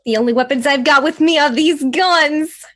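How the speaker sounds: loud, in-character declaration; loud boast. Acted. Player answering the weapons check in character, loud boast.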